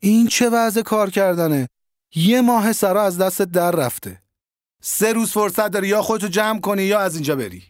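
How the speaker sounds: high-pitched stern telling-off; angry scolding. A narrator's reading. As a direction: scold him hard, voice up high. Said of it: "علی آقا داشت میگفت".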